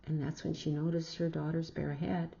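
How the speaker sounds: usual speaking voice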